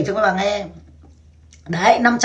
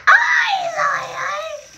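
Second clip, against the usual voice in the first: shrieking exclamation; slower than usual. Retold or imitated. Reacting to the fan's answer with a shriek. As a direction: stretch out a shrill cry. Retold reply then shriek.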